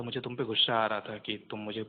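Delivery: ordinary talk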